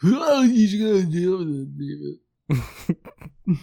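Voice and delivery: high, slow mocking parrot voice; mocking imitation. Mocking the friend's line.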